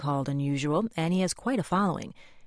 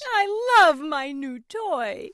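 high, slow, drawn-out cry of delight; Piggy yells her love for the toy; Piggy yells with joy; 10 semitones higher, much slower than usual. Acted. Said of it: "and she yells".

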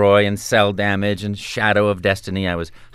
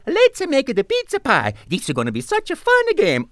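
high bouncy Italian plumber voice; light and fun cartoon hero; Mario voice; 13 semitones higher than usual. Shown as a demonstration. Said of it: "make it a little light and more entertaining and fun".

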